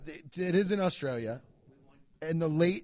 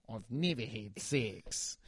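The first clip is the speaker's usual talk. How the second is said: Australian accent; 5 semitones lower, 8 dB quieter, slower than usual. Shown as a demonstration. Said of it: "I can't do an Australian accent except for that sentence".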